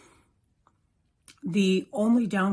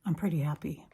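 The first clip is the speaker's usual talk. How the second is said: ordinary talk, nothing marked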